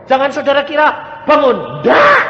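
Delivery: high, loud, forceful no; shouted denial. Real, not acted. Preacher to congregation, shouting no. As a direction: cry out the denial from the pulpit.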